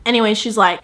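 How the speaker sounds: usual speaking voice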